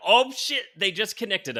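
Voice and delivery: high, loud burst of amazement; excited viewer reaction. Acted. Retelling a viewer's reaction to the teaser, excited and loud. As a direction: exclaim it like a thrilled moviegoer.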